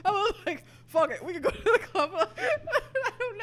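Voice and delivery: high, giggly put-on voice; laughing voice. Real, not acted. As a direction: laugh through a silly voice. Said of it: "What are these voices?".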